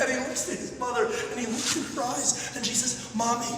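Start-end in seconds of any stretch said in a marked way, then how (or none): 3.1-3.5 put-on high, pleading little boy's voice; child pleading. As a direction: beg like a lost child. Child begging his mother.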